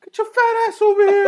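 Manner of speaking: high, loud yelled taunt; squeaky hammed-up catcall; mock heckle. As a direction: shout it across the courtyard at a stranger. Dudes heckling him.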